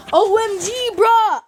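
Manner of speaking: hammed-up high-pitched exclamation; mock excitement. Reading out a commenter's reaction, mock excited.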